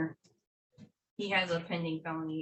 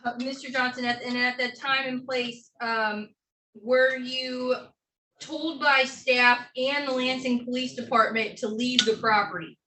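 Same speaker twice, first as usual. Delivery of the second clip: in a courtroom; 4 semitones higher, 7 dB louder than usual. Real, not acted. Attorney questioning defendant in court.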